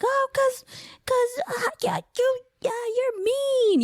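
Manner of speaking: high, halting mock whine of a little boy; high-pitched kid stammering through excuses; crying child. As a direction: imitate a tearful kid, stretching the complaint. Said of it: "so he's crying and".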